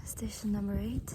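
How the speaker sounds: breathless whisper on the mountain. Real, not acted. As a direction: whisper it, drained and tired. Tired climber whispering at altitude.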